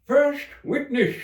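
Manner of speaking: calling out. A narrator's reading. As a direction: announce it like a court herald. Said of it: "called out".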